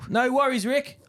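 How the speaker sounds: mock eager reply to the coach; eager player. Player to coach Ricky, a high eager mock reply.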